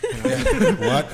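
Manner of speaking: high, loud laughing yeah; a squealed, giggly yeah; laughing mock reply. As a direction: blurt it mid-laugh. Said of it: "I'm like".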